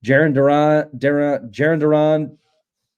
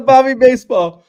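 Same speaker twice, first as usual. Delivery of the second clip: laughing; 12 semitones higher, 8 dB louder than usual. Real, not acted. Teasing Bobby, laughing hard.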